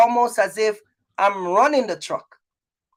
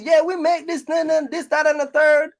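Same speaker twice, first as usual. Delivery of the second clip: mock braggart; 6 semitones higher than usual. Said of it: "And then they show these numbers".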